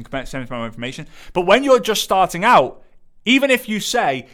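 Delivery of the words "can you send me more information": the request repeated softer and faster, trailing off; fading mock request. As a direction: let it fade into a quick mumble. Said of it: "Flooded with people saying".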